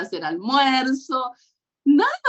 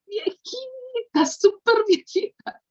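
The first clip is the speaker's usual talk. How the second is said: fond exaggeration; 13 semitones higher, slower than usual. Real, not acted. Showing her old Bible fondly.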